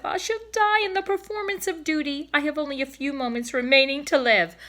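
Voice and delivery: high, melodramatic dying swan; hammed-up farewell of a wounded swan; dying old cob.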